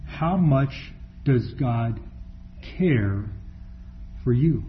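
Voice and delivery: how much does God, pause, care, pause, for you, soft and slow; hushed slow emphatic question. Shown as a demonstration. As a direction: drop the voice and space the words out. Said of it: "So the question again".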